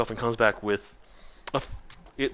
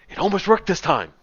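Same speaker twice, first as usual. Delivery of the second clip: high, louder, excited potion maker; excited shop owner; 8 semitones higher, 6 dB louder than usual. Acted. Shop owner after a smoky explosion, excited. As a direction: cry out with thrilled frustration at a near success.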